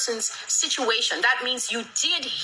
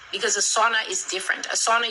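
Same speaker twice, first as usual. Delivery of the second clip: usual speaking voice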